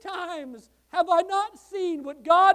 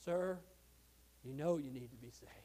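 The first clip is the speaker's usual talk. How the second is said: very soft, low plea; retold gentle urging, dropped low; quiet earnest appeal; 12 semitones lower, 14 dB quieter than usual. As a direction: say it low and hushed, one to one. Retold earnest appeal to old man.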